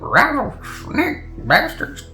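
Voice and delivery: put-on high, slow old frontier doctor. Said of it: "in an old frontier doctor voice".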